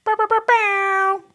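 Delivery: high, drawn-out "bow" noises; imitated jingle. Capping the beer bit, high vocal jingle. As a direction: hold the last high note.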